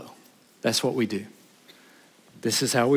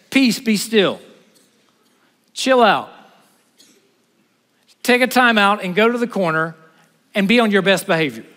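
lightly voiced Jesus, high and firm; a hint of Jesus rebuking the waves; Jesus calming the storm; 5 semitones higher, 4 dB louder than usual. Jesus voiced commanding the sea.

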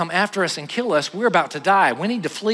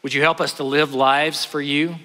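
closing prayer; 4 semitones lower than usual. Acted. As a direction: pray it gently for the whole room.

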